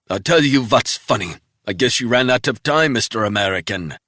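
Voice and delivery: mock him from the ground; sneering captive. Wounded spy taunting Resnick with a sneer.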